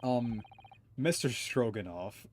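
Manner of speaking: ordinary talk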